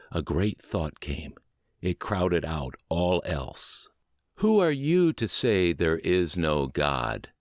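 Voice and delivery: measured, earnest reading of a recovery text; calm podcast narration of a spiritual text; audiobook reading. A narrator's reading. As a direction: read it steady and sincere, like a devotional chapter. Reads a book chapter aloud.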